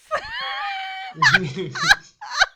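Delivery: huge high shrieking laugh; loud high cackle of delight. Real, not acted. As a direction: explode into a screaming laugh. Table erupts at the joke.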